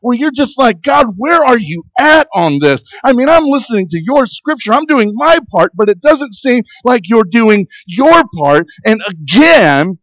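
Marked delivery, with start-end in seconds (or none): 0.8-8.6 higher, pleading and exasperated; frustrated plea. Retold or imitated. Pastor voicing his complaint to God, exasperated and higher. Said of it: "really getting frustrated with God". 8.9-10.0 "again" slowed and leaned on; slow down and press "again"; stress on again. Real, not acted. Preacher to congregation, stressing "again". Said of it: "let me emphasize the word again".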